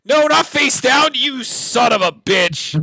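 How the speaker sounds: frustrated yell, slowing at the end; angry shout. Real, not acted. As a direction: yell at the card in real frustration. Card flipped wrong, outburst.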